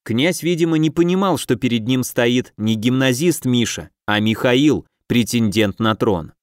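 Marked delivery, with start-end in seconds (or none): none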